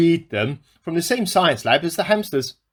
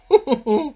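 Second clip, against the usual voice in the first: embarrassed laugh; 7 semitones higher than usual. Acted. Laughs at own absurd claim.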